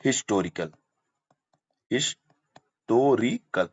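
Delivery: Historical said slowly, with a clear h at the start; slow demo word. Shown as a demonstration. Teacher slowly sounds out the word for the class. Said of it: "बारी हमारी आ गई एच की".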